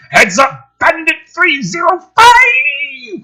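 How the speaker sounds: high, loud, slow drawn-out warning shout; filtered radio warning. Acted. Pilot warning the jets, loud high shout. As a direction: yell the alert to the squadron. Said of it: "Voice two, filtered".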